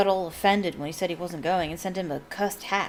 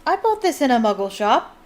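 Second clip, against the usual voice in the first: raised, louder, stubborn retort; 5 semitones higher, 6 dB louder than usual. A narrator's reading. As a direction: dig your heels in as an old wizard. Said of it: "said the old wizard stubbornly".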